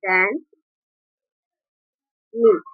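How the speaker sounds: very slow, long silences between words; halting teacher's dictation pace. Shown as a demonstration. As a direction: dictate it as if writing each word out. Dictating example while writing it.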